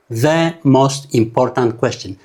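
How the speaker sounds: slow word by word. Shown as a demonstration. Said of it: "ብምሉኣ ከምታ በይና ክነንባ ከለና ኣይነንባይና".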